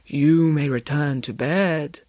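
slow, stately dismissal; authoritative, dramatic. A narrator's reading. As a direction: grant permission slowly, with theatrical grandeur. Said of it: "as authoritative and dramatic as she could".

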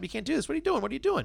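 high, frantic pleading at the parrot. Retold or imitated. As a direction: beg the parrot to stop, voice climbing high. Owners yelling at cursing parrot.

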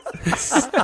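squealing laugh; high laugh. Real, not acted. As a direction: breaks up laughing. Laughing at beer joke.

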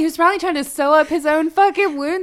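loud, high, emphatic agreement; excited shout. Real, not acted. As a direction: cry out in delighted agreement.

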